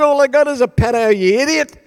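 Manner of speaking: loud, high-pitched indignant yell; exasperated retort. Retold or imitated. Storm survivor snaps at cheerful passing boy, exasperated yell. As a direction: yell it back in mock outrage.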